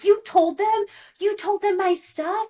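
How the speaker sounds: high, loud retold outburst; mock angry yell. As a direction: shout it back as the betrayed friend. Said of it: "Rebecca yells at Aaron".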